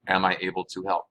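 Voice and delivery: ordinary talk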